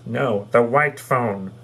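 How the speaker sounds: slow, lightly voiced telephone; slow mock phone voice. The phone corrects him, slowly and lightly voiced. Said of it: "the telephone says".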